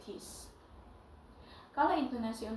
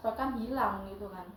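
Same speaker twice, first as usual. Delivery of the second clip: ordinary delivery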